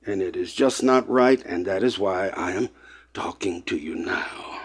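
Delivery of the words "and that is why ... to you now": dropped whisper. Real, not acted. Emotional close, dropped voice.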